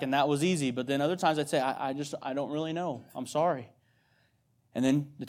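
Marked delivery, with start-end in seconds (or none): 1.6-3.7 soft, hesitant admission; retold gentle apology. Dad apologising to sad daughter.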